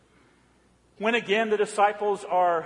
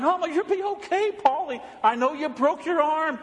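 higher, fretful imitation of a tearful mother; mock crying mom; 8 semitones higher than usual. Mother driving her injured son to hospital, mock tearful and fretful. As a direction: play a frantic mother fussing over her son, pitched up. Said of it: "Mom was a basket case, crying".

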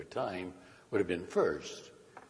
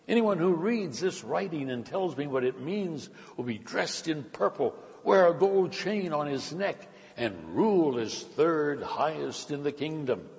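raised, higher-pitched royal announcement; loud proclamation; 8 semitones higher, 7 dB louder than usual. A narrator's reading. King promises rewards to his advisors, loud high proclamation. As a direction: proclaim it to the whole court. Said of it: "The king called out loudly".

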